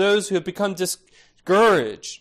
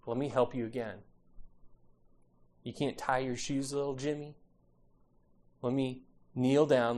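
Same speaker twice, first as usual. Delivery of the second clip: put-on low, soft and gentle, as to a small child; hushed, tender parent voice; patient parent; 5 semitones lower, 10 dB quieter than usual. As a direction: patiently coax a struggling little one. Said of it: "What does a good parent do?".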